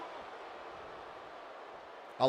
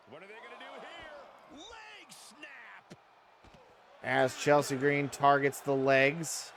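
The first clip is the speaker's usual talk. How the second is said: wrestling commentator; 3 semitones higher, 3 dB quieter than usual. Acted. Live wrestling game play-by-play.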